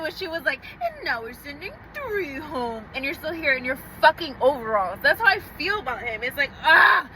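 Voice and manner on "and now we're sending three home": slow, softer reality-show host announcement; Leah from Love Island. Retold or imitated. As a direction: lightly mimic a dramatic TV elimination. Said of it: "you know Leah from Love Island".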